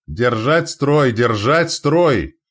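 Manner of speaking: loud, high, drawn-out battle cry; shouted command. A narrator's reading. Андрей holds the line while fighting, shouting. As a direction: stretch the order out in a big shout. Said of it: "кричал Андрей".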